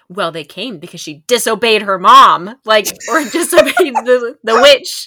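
loud, emphatic parental explanation; hammed-up cautionary parent; mock parent lecture. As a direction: lay down the moral loudly as a parent. Parent answering child, scolding story moral.

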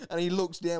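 normal talking voice

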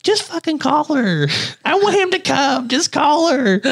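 imitating the wife, high and slow, urging him on; brother's wife. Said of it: "now wife would just be like".

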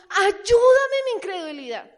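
loud high plea; loud, desperate cry for help. Acted. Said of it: "gritó".

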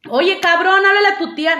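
loud, high, bossy aunt yelling an order; imitating aunts. Mimicking her aunts ordering her around, loud and high. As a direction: holler it like an aunt sending you off. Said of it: "no me sale ahorita".

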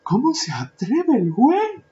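very high, loud mock gasp of indignation; outraged teachers. Imagined teachers reacting with high-pitched mock outrage. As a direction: play scandalized teachers clutching pearls. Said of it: "Ya me imagino a los teachers".

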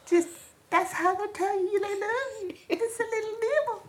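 high, soft little possum voice; nervous sleepy possum. Pretend. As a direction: coo it like a tiny nibbling critter. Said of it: "that's my possum voice".

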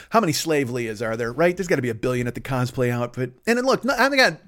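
usual speaking voice

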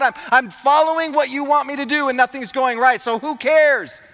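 high, loud exasperated complaint to God; frustrated rant. Retold or imitated. Pastor reliving his complaint to God, frustrated high rant. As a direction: re-enact being fed up, voice pushed up high.

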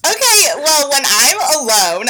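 loud, high, excited protest; excited screaming. Real, not acted. Owning up to screaming at shows alone, excited yelling. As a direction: belt it out over the others, pitched right up. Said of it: "I do scream".

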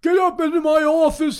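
loud, high-pitched furious yell; angry boss. Retold or imitated. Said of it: "he just started screaming".

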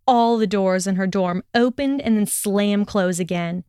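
usual speaking voice

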